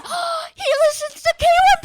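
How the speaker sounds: put-on thrilled childish shriek; excited squeal. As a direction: squeal in kid delight. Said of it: "And I'm like".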